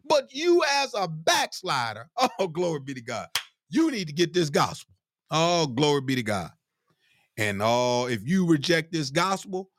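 high, chanting preacher's exhortation; preaching at full force. Acted. Preacher warns backsliders, preaching at full force. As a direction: plead with the backslider, rising and falling.

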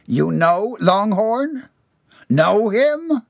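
high, drawn-out, building to loud; rising outrage. A narrator's reading. Boss learning John knows the rival, rising outrage. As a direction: swell from disbelief into a loud demand. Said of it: "his voice rose voluminously".

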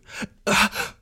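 airy giggle under the breath; breathy laugh. Real, not acted. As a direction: let out a breathless little laugh. Laughing at own hyper state.

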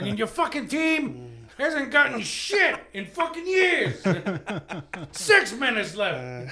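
high, loud mocking tirade; comic roasting the Philly crowd, loud rant; Bill Burr. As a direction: tear into the crowd, loudly. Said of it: "He's like".

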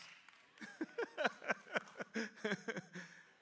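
high bright laugh. Real, not acted. Preacher laughing with the congregation over the time. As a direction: laugh along with the room.

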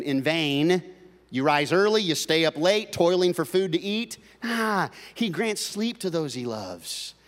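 loud scripture proclamation; preaching at full force. Acted. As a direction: declare the psalm to the congregation. Preacher reads Psalm 127.

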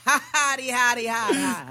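high mock Boston cackle; laughing in Boston accent. Comic mimics the sister's crowd laughing, mock Boston-accented laugh. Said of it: "they're laughing in their accents".

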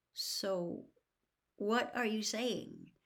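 slow and wary question; slowly. A narrator's reading. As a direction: draw the question out carefully. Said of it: "said Harry slowly".

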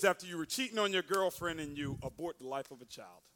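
ordinary talk

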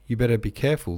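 contained shout of warning. A narrator's reading. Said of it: "the director shouted".

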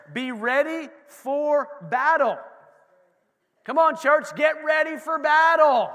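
loud, slow battle call to the church; rallying shout. Real, not acted. Pastor rousing the church to get ready, shouting. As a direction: rouse them for battle.

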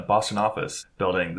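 "office", a hanging gap, then "building"; long pause mid-phrase. Real, not acted. Co-host reading the TV guide blurb, long pause before "building". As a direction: leave a long hesitation between "office" and "building". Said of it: "Such a pause between office and building".

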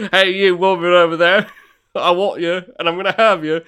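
mock swaggering flirt; bold flirt. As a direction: play the brazen seducer for laughs. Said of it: "I'd just be like".

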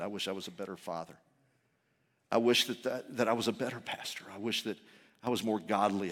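low, hushed confession to God; pleading prayer. Retold or imitated. Sick pastor confesses his sins to Jesus, quietly pleading. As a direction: plead very softly, voice sunk down. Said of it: "I was pleading for mercy".

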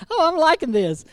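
ordinary delivery

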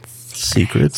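breathy hushed whisper. Real, not acted. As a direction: whisper the word back. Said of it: "Why is this a whisper?".

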